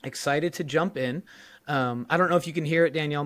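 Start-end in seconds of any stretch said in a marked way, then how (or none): 1.7-3.2 talking with a half-lost, hoarse voice. Real, not acted. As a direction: voice slightly off, close to cracking. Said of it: "My voice is a little weird".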